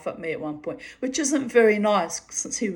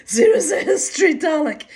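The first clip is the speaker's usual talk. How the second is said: put-on high, loud, quick German-accented quip; hammed-up German accent, pushed high; mock German accent; 10 semitones higher, 9 dB louder, faster than usual. Woman sending up her German teacher, hammed-up German accent. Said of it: "'cause he's German".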